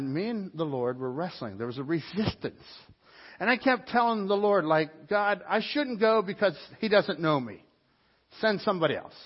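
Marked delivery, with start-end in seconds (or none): 5.1-9.1 raised, pleading protest to God. Retold or imitated. Preacher retelling his prayer, raised and pleading. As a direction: beg God to pick someone else.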